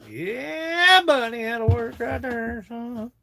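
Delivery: high slow put-on voice; figure's voice answering.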